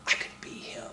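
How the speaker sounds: whispered echo, wistful; dreamy whisper. Retold or imitated. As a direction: breathe it as a secret hope. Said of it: "the average man said".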